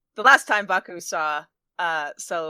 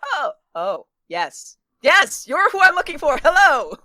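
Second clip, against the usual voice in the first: high, loud startled hello; jump, then greet brightly; 8 semitones higher, 6 dB louder, slower than usual. Acted. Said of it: "startled, Baku grabbed".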